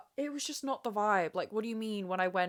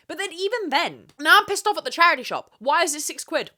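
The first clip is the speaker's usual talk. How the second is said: angry rant; 7 semitones higher, 9 dB louder than usual. Real, not acted. Angry rant about charity shop prices.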